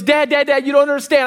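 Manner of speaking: urgent, high-pitched retold plea; imitated pleading child. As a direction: lightly voice a son begging to be heard. Upset son pleading with dad.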